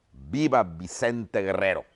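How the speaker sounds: ordinary delivery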